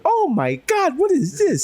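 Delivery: very high gasping amazement; hammed-up astonishment with a laugh in it; mock surprise. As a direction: discover a wonder in the fridge. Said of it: "open my fridge and be surprised".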